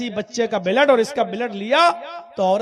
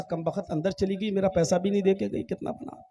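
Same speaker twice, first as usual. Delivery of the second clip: softer, muttering to himself about the lost fare; under-the-breath grumble; 2 semitones lower, 7 dB quieter than usual. Acted. (धीमी बड़बड़ाहट)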